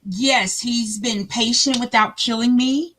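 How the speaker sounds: ordinary talk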